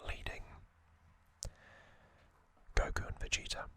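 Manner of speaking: usual speaking voice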